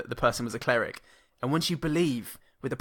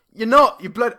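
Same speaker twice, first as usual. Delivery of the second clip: higher, louder mock accusation; hammed-up accusing yell; mock accusing shout; 9 semitones higher, 10 dB louder than usual. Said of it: "he goes".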